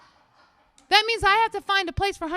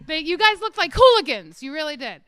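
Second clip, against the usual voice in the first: usual speaking voice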